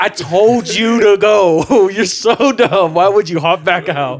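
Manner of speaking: slow, laughing mock scolding. Scolding his friend, a slow laughing scold. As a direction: tease him while laughing.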